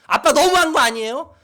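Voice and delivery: put-on loud, high demonstration of a child's anger; angry protest. Shown as a demonstration. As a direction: confront your father at full voice. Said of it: "화를 내야 된다".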